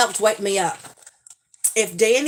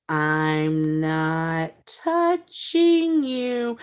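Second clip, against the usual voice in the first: put-on a slow, drawn-out taunting kid voice; teasing little brother; 2 semitones lower, much slower than usual. Son taunting his sister in the car, a slow singsong tease. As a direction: stretch every word out to needle his sister. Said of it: "he would get right here to Jasmine and go".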